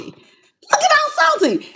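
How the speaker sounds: high, slow mocking "Looking all salty". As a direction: tease them high and slow.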